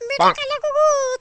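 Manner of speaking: slow, high, loud hammed-up wail; wife begging husband not to leave, wailing; mock wailing. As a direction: drag it out in a fake sob.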